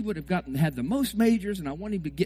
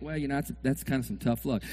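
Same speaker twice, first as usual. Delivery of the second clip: low, soft resigned reply; mock calm friend; 5 semitones lower, 7 dB quieter than usual. Calm friend answering the upset fan, softly. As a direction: shrug it off quietly.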